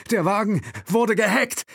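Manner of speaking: high, loud, alarmed realisation; shocked stammer. Acted. Will realising the car is hijacked, blurting in shock. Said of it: "stammelte Will".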